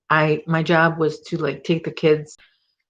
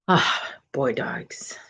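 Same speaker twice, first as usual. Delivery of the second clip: dreamy whisper; 4 semitones lower, 6 dB quieter, much slower than usual. Real, not acted. Dreaming aloud about boy dogs.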